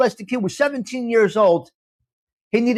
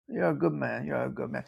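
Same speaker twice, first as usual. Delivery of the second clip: murmured thanks; 6 semitones lower, 11 dB quieter than usual. Real, not acted.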